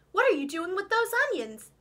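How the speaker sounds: a watchful farm dog checking on her; suspicious collie dog. A narrator's reading.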